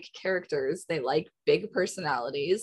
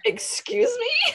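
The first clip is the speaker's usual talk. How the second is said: much higher, slow, laughing indignation; mock offended Heidi; 4 dB louder, slower than usual. Imitating Heidi snubbing a contestant's back talk, mock indignant. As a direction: stretch it out, outraged and amused. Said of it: "Heidi was just like".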